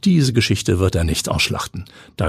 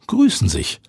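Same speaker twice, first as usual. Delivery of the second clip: ordinary delivery, nothing marked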